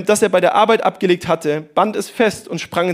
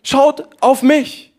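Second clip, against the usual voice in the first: slow, higher, every word weighed; emphatic call; 5 semitones higher, 3 dB louder, much slower than usual. Acted. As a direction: plead it slowly and clearly. Jesus' call, preached emphatically.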